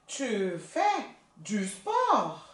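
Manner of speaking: Tu fais du sport slowly, sport with no T; teacher modelling slowly; slow model sentence. Shown as a demonstration. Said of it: "Ainda não pronuncia o T".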